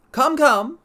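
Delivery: slow, high, loud "Come, come"; bustling. A narrator's reading. Blessington hurrying the doctor, bustling high voice. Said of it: "in his bustling way".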